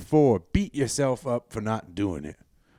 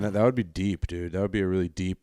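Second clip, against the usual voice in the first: ordinary delivery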